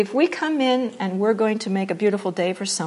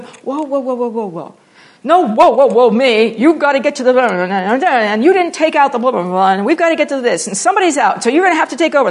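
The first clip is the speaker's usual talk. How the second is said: mock-panicked rush of orders, much louder; flustered work chaos; 3 semitones higher, 7 dB louder than usual. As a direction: pile the demands on like a frantic coworker. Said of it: "Even if you're going".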